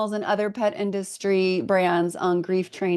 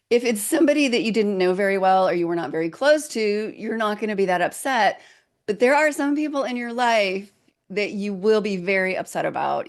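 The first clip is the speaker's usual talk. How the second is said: usual speaking voice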